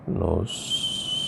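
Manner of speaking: NOSOTROS stretched very slowly, syllable by syllable; drawn-out syllables. Shown as a demonstration. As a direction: sound out NOSOTROS softly as if writing it. Sounds out word while writing it.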